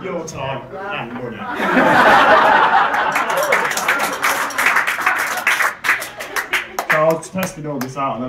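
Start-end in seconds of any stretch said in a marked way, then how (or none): 1.4-6.9 long loud audience laughter; crowd laughter. Real, not acted.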